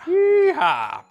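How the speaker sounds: high, loud cowboy whoop; cowboy yeehaw. Pretend.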